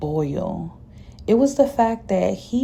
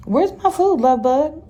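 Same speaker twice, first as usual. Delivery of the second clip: high, louder puzzled asking; confused question; 9 semitones higher, 5 dB louder than usual. A narrator's reading. Narrator finds no food with the woman, high confused question. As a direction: ask it baffled, pitch jumping up.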